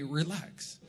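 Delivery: dad's relax. Retold or imitated. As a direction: hand down dad's calm brush-off. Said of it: "the words that my dad used to say to me".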